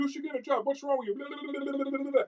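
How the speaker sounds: high-pitched scolding rant; mock yelling father. Father yelling at [name], in a mock high yell. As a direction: imitate a father nagging at the top of his pitch. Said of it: "his father's yelling at him".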